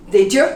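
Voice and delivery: Did you blended, y turned to j; did you as didja. Shown as a demonstration. Teacher shows students Did you blended, rising. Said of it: "The Y becomes a J".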